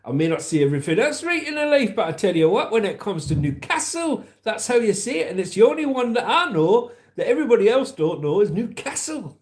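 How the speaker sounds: loud, high Newcastle accent; Geordie accent. Shown as a demonstration. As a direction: rant in an exaggerated high Geordie voice. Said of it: "And Newcastle".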